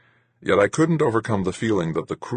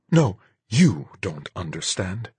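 venomously low; 3 semitones higher, slower than usual. A narrator's reading. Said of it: "her voice venomously low".

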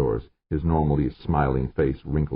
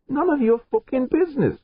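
much higher, strained snarl of a voice; 3 dB louder than usual. Acted. George rebuffing the nosy chief, raised snarl. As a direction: spit it out in a raised voice. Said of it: "George snarled".

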